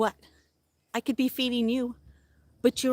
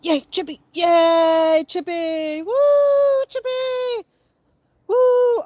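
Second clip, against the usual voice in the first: much higher, loud woo of triumph; cheering; 9 semitones higher, 8 dB louder, much slower than usual. Real, not acted. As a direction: celebrate like he just scored. Celebrating squirrel finding nut.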